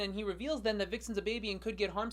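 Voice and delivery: usual speaking voice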